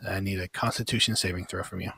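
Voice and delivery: ordinary talk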